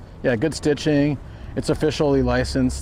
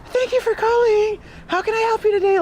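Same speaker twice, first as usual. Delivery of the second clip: much higher, sugary phone-greeting voice; phone customer service voice. Retold or imitated. Imitating a nightmare manager answering the phone, much higher fake-sweet voice. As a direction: imitate the boss's fake-nice phone manner. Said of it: "she'd pick it up and be like".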